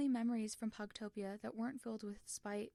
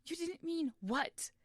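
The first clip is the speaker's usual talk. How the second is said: higher, louder, slow furious demand; hurt, angry challenge; exclaimed; 8 semitones higher, 6 dB louder, slower than usual. Acted. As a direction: throw the words back at him. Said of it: "Tommy exclaimed".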